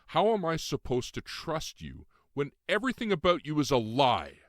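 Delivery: rising to a near-tearful shout; said loudly. Acted. Ren confronting Lucian about his lies, loud and near tears. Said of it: "I said loudly".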